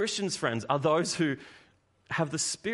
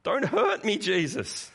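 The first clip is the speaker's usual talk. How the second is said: high, loud mock plea for mercy; imitated demon cry; 8 semitones higher, 4 dB louder than usual. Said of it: "is the cry of the demons".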